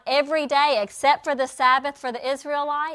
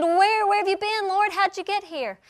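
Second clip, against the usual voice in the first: eager crowd; 6 semitones higher than usual. Retold or imitated. Crowd asking Jesus eagerly.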